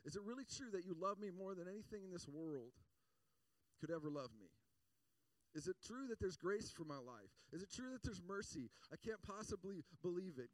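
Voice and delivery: impassioned doubting plea. Acted. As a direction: plead with God in disbelief, voice pitched high. Acted doubting plea to God.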